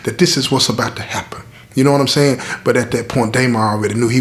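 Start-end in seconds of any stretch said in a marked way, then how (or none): none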